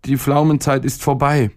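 put-on high-pitched, mildly grumpy child; sullen boy. A narrator's reading. Boy grumbling to his stomach, mildly sullen. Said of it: "brummte der Junge verdrießlich".

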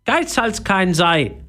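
high, slow parrot reproach. A narrator's reading. Parrot chides Philipp for stinginess, high slow voice. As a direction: chide slowly in a squawky bird voice. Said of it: "sagte der Papagei".

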